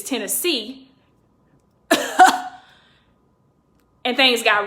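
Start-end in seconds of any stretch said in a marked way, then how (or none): 1.9-2.5 short unvoiced burst of laughter. Real, not acted. Storyteller amused at ending up in Memphis, short laugh. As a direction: laugh briefly at your own story.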